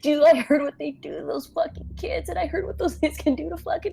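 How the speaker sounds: high, strained, urgent pleading; frightened pleading. Acted. Boy held back from escaping, begging in fear. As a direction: plead with pitch rising as the fear builds.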